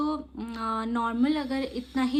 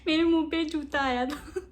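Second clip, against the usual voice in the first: real laughter while telling, louder; laughing; 4 semitones higher, 6 dB louder than usual. Real, not acted. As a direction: laugh through the memory. Recalls shoe hitting face. (याद करते हुए ज़ोर से हँसती हुई)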